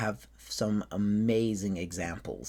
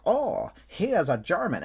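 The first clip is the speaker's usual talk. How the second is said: put-on high, exclaimed German accent; impressed listeners, German accent; 8 semitones higher than usual. Americans recognising the accent, high exclaimed German accent. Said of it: "and they're like".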